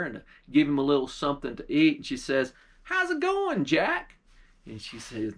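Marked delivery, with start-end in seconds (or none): 2.8-4.2 put-on lifted, higher girl's voice; a bright young girl checking on Jack. A girl asks Jack how it's going in a higher voice.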